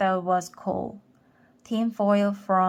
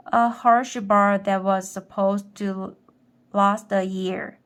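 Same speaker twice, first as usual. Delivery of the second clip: plain reading voice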